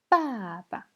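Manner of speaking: "Papa" falling from a long first syllable to a light second; neutral tone. Shown as a demonstration. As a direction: stretch and drop the first "Pa", tap the last lightly. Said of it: "on va voir le ton neutre".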